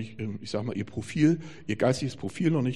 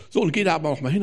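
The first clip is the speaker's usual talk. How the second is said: usual speaking voice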